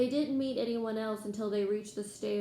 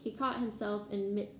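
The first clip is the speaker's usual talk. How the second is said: usual speaking voice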